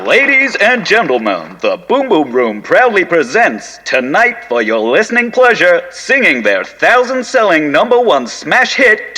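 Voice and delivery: booming nightclub emcee; big showbiz announcer voice. Acted. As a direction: introduce the act to the whole room. Stage announcer intro.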